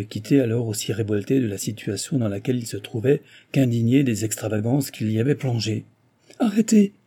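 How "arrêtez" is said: high, loud command to halt; sharp high exclamation; urgent cry. A narrator's reading. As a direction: cry out to stop someone leaving. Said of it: "s'écria-t-il".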